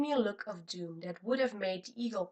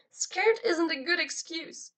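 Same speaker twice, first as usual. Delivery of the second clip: mild shout; 8 semitones higher than usual. A narrator's reading. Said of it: "she shouted into the couch".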